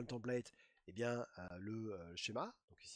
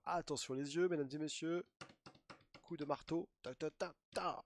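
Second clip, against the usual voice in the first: higher, playful showman's build-up with a drumroll; mock showman; 5 semitones higher, 4 dB louder than usual. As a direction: announce it like a fairground act. Mock showman announcing a reveal.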